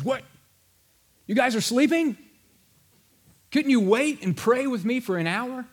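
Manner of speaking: Jesus waking sleeping disciples, incredulous; surprised rebuke. Retold or imitated. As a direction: catch them asleep and be amazed. Jesus finds disciples asleep.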